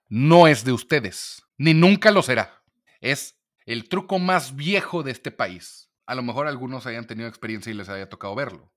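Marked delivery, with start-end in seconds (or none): 0.1-2.6 loud, emphatic warning, hammering each word; firm loud warning to the ladies; forceful warning. Real, not acted. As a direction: drive the point home hard. Lawyer warns women about gifts.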